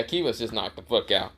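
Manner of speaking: usual speaking voice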